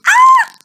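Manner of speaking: short, loud, high mock scream; playful scream. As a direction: let out a pretend shriek for the kids. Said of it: "don't forget to scream".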